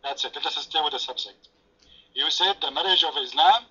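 normal talking voice

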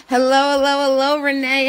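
ordinary talk, nothing marked

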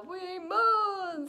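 high, drawn-out spooky moan; long playful groaning call; mummy moan. A narrator's reading. Little story mummies moan.

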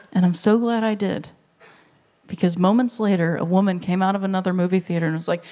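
ordinary talk

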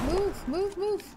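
panicked shout. Real, not acted. Panicked dodging.

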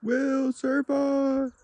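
high, slow sing-song catchphrase. Pretend. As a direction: drag it out up high. Drawn-out catchphrase.

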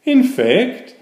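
lively read-aloud story voice; storytelling. A narrator's reading. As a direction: read it like a picture book to kids. Reads a picture book aloud.

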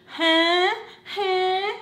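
high-pitched, questioning whine; mocking whiny talk. Cleaner mocking how her employers talk, high whine. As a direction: mimic their annoying upward whine. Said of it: "that's how they kinda would talk".

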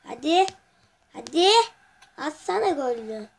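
loud rising cries of Hadi; excited urging. Real, not acted. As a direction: push the ball in with your voice. Urging ball into goal.

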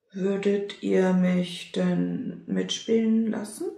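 usual speaking voice

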